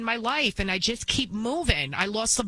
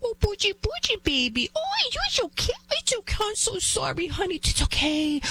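put-on much higher, cooing, babying voice; doting mom; 13 semitones higher than usual. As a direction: coo over a spoiled child like a doting mother. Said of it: "the mom is like".

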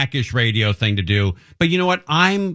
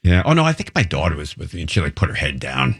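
ordinary talk, nothing marked

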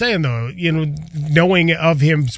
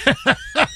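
high, real laugh; helpless high-pitched laughing; burst of laughter; 10 semitones higher than usual. Real, not acted. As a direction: crack up at the joke. Laughs at the co-host's joke.

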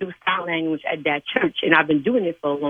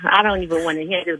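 usual speaking voice

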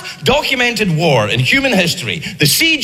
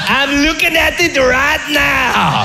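mock French Trojan taunting; 4 semitones higher, slower than usual. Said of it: "were like".

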